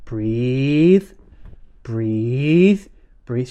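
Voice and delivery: slow soothing breathe; calm breathing coach. Retold or imitated. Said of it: "Remember the breathing".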